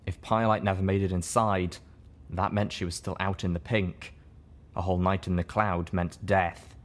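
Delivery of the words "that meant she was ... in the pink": ordinary delivery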